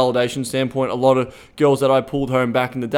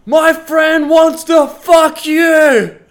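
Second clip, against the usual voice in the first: high, drawn-out shout across a distance; imitated yell; 13 semitones higher, 8 dB louder, much slower than usual. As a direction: holler it slowly, like a woman calling from far away. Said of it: "this woman yells out and goes".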